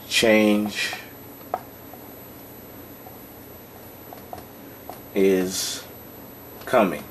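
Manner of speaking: very long pauses between words; slow dictation. Real, not acted. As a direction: dictate at writing speed, word by word. Writing the example out as spoken.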